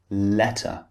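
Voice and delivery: "letter" with a crisp t in the middle; "letter", clear t, no American d; British T. Shown as a demonstration. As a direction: say "letter" the British way, t fully sounded. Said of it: "The T sound is always very".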